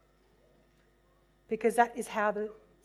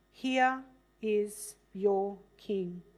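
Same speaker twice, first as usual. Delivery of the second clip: slow presentation; much slower than usual. Acted. Pilate presents Jesus.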